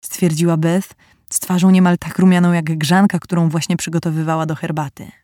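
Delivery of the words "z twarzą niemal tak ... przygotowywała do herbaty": ordinary delivery, nothing marked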